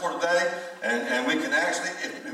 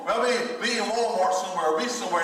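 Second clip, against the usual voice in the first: normal talking voice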